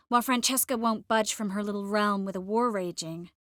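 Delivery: normal talking voice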